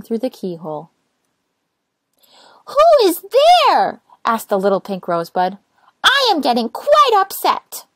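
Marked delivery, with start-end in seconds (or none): 2.7-4.0 high, loud call through the door; little rosebud demanding who knocks. Acted. Rosebud calls out loudly. 6.0-7.9 high, loud and cross; annoyed little rosebud. Acted. Rosebud upset.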